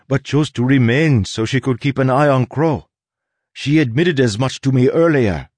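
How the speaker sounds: insistent Soleil. Acted. Soleil argues to the crew that Tress is a mask, insistent. Voices Soleil arguing her case.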